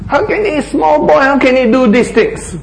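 high, disbelieving scoff; mocking disbelief, pitched well up; scornful doubters. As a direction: sneer at the small boy. Voicing doubters of David.

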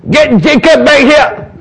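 high, loud call to bring him back. Acted. Isaac calling for Jacob to return, loud call. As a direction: shout it across the tent.

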